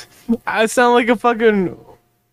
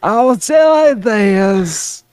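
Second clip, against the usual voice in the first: drawled dry southern impression; southern accent in the manner of Merle; 2 semitones higher than usual. Friend trying to sound like Merle, dry southern accent. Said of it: "that's exactly how he sounds".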